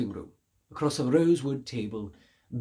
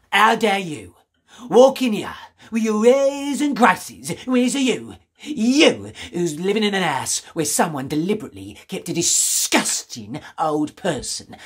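furious tirade; 5 semitones higher, 4 dB louder than usual. Acted. Shop woman berating the stranger for his airs: furious loud tirade. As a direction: spit the words out in disgust.

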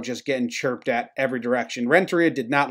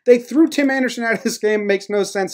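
normal talking voice

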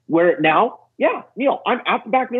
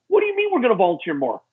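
high-pitched mock outrage; re-enacted incredulous retort; indignant disbelief; 6 semitones higher than usual. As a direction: protest it as if affronted, pitch up. Retold outraged retort to wife.

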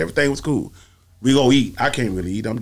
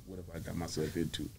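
much softer, muttered to himself; inner worry; 7 semitones lower, 20 dB quieter than usual. Retold or imitated. Sitting in the van, quiet inner worry. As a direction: think it aloud under the breath. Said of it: "just looking at his face like".

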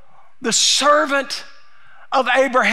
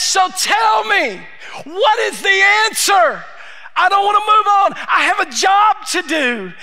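urgent; 6 semitones higher than usual. Acted. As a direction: press them for an answer now. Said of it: "There's an urgency here in the servant's voice".

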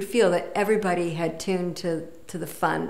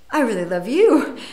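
mock eager golfer; 5 semitones higher, 8 dB louder than usual. Retells the golfer's answer.

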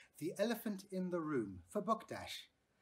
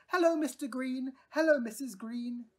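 cheerful greeting; 8 semitones higher, 8 dB louder than usual. A narrator's reading. Lindy waving to neighbours.

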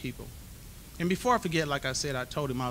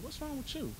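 much higher, softer imitated woman's voice; friend's concerned question; 13 semitones higher, 8 dB quieter than usual. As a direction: lift into her voice, gently puzzled.

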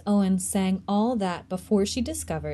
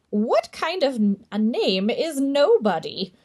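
shocked, louder, higher indignant question; a ghost matron appalled at the name; scandalized; 6 semitones higher, 5 dB louder than usual. Acted.

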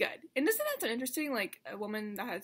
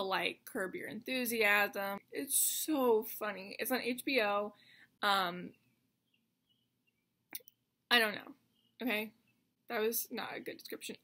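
ordinary talk

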